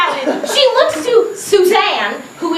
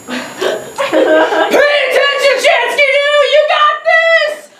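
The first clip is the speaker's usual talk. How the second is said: dad yelling; 7 semitones higher than usual. Retold or imitated. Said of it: "I hear my dad yell".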